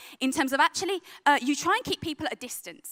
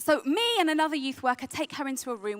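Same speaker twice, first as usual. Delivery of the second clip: ordinary talk, nothing marked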